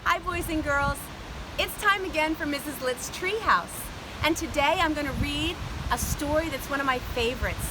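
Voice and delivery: put-on bright, high children's host voice; sing-song welcome for little kids; overbright kids party host. Kids show host greeting.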